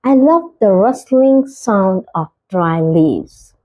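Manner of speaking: very slow, each word spaced out; slow clear example. Shown as a demonstration. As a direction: model the sentence slowly for learners. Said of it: "example sentence read slowly". (धीरे, साफ़ उदाहरण वाक्य)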